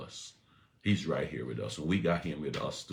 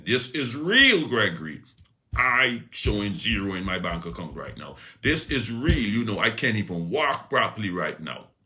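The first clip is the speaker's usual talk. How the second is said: louder, insistent voice of a doubter; doubting churchgoer; 4 semitones higher, 8 dB louder than usual. Retold or imitated. Churchgoer insisting to the preacher their problems are real, loud and insistent. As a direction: protest that your trouble is real. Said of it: "because you're saying".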